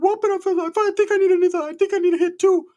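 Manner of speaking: very high mock cousin voice; Ricky. Cousin saying he needs a hit too, very high put-on voice. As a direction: squeak it out in a put-on falsetto. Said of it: "Ricky's like".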